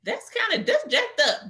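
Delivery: high, incredulous self-talk; shocked realization. Retold or imitated. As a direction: catch herself in disbelief. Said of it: "dig deep and be like".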